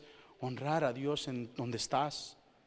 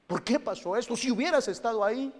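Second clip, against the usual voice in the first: louder, higher accusing cry; a believer blames God, rising to an accusing cry; blaming God; 8 semitones higher, 6 dB louder than usual. Retold or imitated. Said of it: "las culpas que le echamos a Dios".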